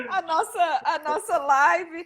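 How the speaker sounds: words broken by giggles, pitched high; real amused giggly speech; laughing while talking. Real, not acted. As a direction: talk through your own laughter. Host laughing while explaining.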